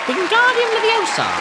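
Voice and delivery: high, squeaky wizard incantation; mock spell casting. Vlogger jokingly casting a spell to lift the dumbbell, squeaky high incantation. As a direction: cast it like a playful wizard.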